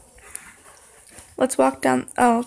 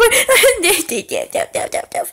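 nervous laugh; 6 semitones higher than usual. Real, not acted.

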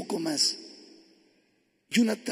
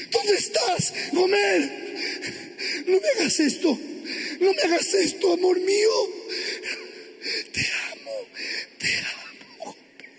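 high, desperate cries breaking down; tearful pleading; 8 semitones higher than usual. Acted. Husband begging his lost wife, crying desperately. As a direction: plead until the voice gives out into a faint squeak.

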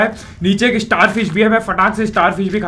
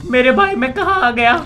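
ordinary talk

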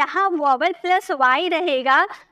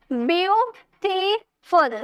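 Beautiful slowly in three beats, byu, ti, ful; syllable by syllable; 3 semitones higher, much slower than usual. Shown as a demonstration. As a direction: break beautiful into three drawn-out pieces. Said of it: "तीन syllable आए ना".

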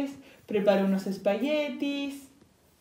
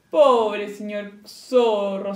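sighing; 8 dB louder, much slower than usual. A narrator's reading. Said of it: "suspiró el cerdito".

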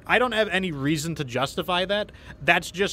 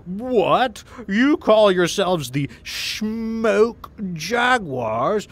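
loud, high, slow gloating villain; villain taunt; 7 semitones higher, 7 dB louder, slower than usual. Retold or imitated. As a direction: sneer it like a cartoon villain. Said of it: "a Inner Sphere mech warrior named Helmar Valasek says".

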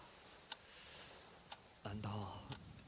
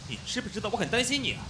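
high, loud worried scold; 8 semitones higher, 4 dB louder, faster than usual. Acted. Roommate scolds the soaked friend, worried and loud. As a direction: let the worry burst out as scolding.

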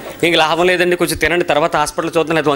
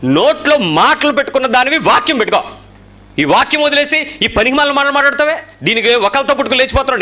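angry preaching; 8 semitones higher than usual. Real, not acted. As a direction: scold the listener hard. Preacher scolding at full force. (కోపంగా గద్దించే ప్రసంగం)

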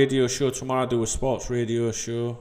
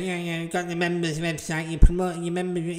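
a sneering voice for the complainers; lightly mock the whining critics; mocking moaners; 4 semitones higher than usual.

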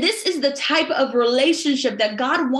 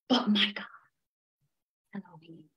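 hushed whisper; 8 semitones lower, 9 dB quieter, faster than usual. Real, not acted. Intimate climax of the sermon.

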